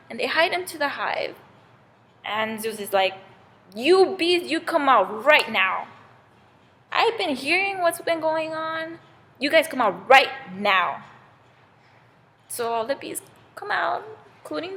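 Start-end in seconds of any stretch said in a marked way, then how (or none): 3.8-11.0 stern, emphatic order with weighty pauses; angry summons. Acted. Angry Zeus to hiding bees, stern summons. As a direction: order them out, hitting each word. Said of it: "they see how angry he is".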